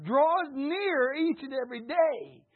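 high, loud, slow preaching cry; preaching swell. Real, not acted. Preacher calling out to the congregation, loud and drawn out. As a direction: lift it up and stretch it out.

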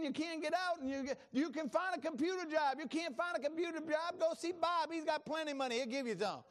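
high energetic sermon delivery; preaching. Acted. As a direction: preach it out to the congregation. Preaching at full force opening.

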